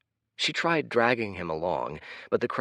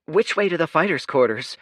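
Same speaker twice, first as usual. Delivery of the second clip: Kaya asking; 8 semitones higher, 6 dB louder than usual. Acted. Kaya asks the kid.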